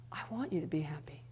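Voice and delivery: whispered, intimate; tender whisper. Pretend. God tells the weary listener, whispered tenderly. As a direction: breathe it like a loving secret.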